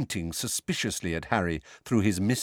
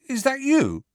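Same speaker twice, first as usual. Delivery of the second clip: higher hopeful disbelief; disbelieving; 4 dB louder than usual. Acted. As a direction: ask it as if it can't be true. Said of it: "he said disbelievingly".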